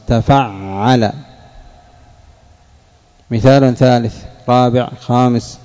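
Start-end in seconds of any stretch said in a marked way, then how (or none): 0.1-1.1 تفعل stretched out slowly, each syllable clear; teacher spelling out تفعل at dictation pace; slow pattern demo. Shown as a demonstration. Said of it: "كررنا الأصل".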